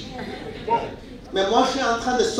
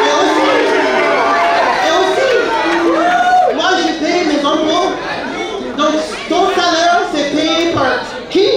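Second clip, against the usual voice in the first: louder and higher, repeating "et aussi"; insistent loud retort; 4 semitones higher, 4 dB louder than usual. Retold or imitated. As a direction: hammer the point home, defiant. Arguing back at police, rising. (réplique forte et insistante)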